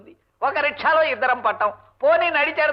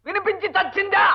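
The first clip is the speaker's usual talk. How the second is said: high, irritated repeat; exasperated shout; 5 semitones higher than usual. Acted. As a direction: snap it out, fed up with repeating. Repeats angrily, she did not hear. (చిరాగ్గా గొంతు పెంచి)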